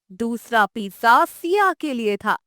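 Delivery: ordinary delivery, nothing marked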